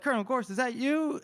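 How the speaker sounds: high-pitched surprised calling voice; imitated onlooker. Co-host voicing soldiers noticing the colonel, high mock surprise. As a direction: call out as a startled soldier would.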